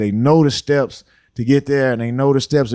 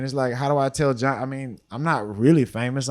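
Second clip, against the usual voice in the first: usual speaking voice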